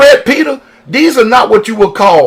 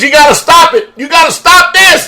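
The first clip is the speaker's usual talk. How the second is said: frightened Israelites; 8 semitones higher than usual. Retold or imitated. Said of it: "some of them stayed behind Moses".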